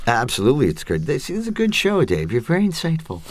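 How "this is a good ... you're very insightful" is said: much higher, teasing mock praise; mock flattery. Real, not acted. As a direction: tease the host with sing-song flattery. Joking praise to host.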